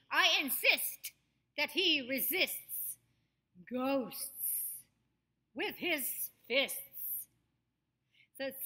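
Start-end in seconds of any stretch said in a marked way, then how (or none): 0.1-6.8 slow, forceful INSIST with a sharp final T, FISTS fully sounded; hard-hit T's. Shown as a demonstration. Coach says hit me with that T, INSIST crisply finished. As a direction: hit every T in INSIST, RESISTS, GHOSTS, FISTS. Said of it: "Hit me with that T".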